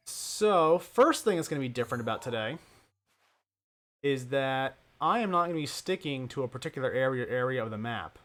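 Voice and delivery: usual speaking voice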